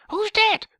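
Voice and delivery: very high, loud squawked question; squeaky mock old woman; old lady voice. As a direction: interrupt as a clueless old lady. Imagined old lady's idiotic questions.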